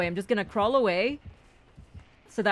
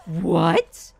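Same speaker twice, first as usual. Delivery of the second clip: loud disbelief; 7 dB louder than usual. Real, not acted. Player baffled by the hook, loud disbelief. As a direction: blurt it out, astonished.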